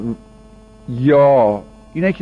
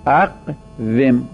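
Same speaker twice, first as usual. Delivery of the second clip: slow demo; much slower than usual. Shown as a demonstration.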